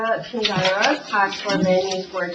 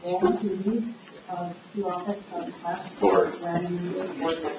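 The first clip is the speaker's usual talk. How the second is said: echoing a beat behind; 6 dB quieter than usual. Real, not acted. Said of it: "repeat after me".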